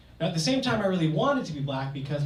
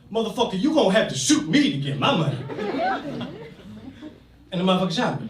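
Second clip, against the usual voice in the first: louder, tough-guy defiance; defiant; 6 semitones higher, 5 dB louder than usual. Retold or imitated. Patrick telling the robber off at the ATM, loud and defiant. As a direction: dare him to shoot.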